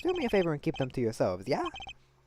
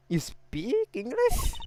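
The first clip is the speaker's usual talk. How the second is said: very slow, higher, stretched-out rising question; drawn-out disbelief; 13 semitones higher, 3 dB louder, much slower than usual. Acted. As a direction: drag every word out in disbelief. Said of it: "I mean".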